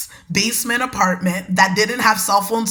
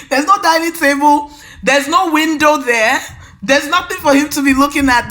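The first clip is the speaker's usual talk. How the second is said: loud, raised, emphatic disbelief; indignant; 6 semitones higher, 4 dB louder than usual. Real, not acted. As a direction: insist there's nothing there. Indignant aside about the kitchen.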